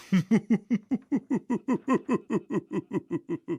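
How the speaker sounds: jeering crowd laugh; mocking laughter. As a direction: laugh at the fool. Peasants laugh at the knight.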